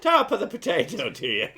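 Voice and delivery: high-pitched mock Irish greeting; jokey, lifted Irish accent. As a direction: ham up a silly stage-Irish brogue. Joking mash-up of stage-Irish phrases.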